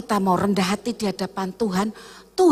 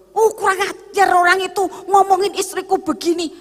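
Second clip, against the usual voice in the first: a hint of an indignant husband, higher and louder; mock angry husband; 7 semitones higher, 5 dB louder than usual. Husband fuming at the man who insulted his wife, mock outrage. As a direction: huff like a husband up in arms.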